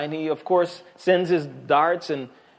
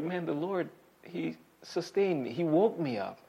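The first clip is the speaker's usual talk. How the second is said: hushed wonder; 7 dB quieter than usual. Retold or imitated. David marvelling on waking.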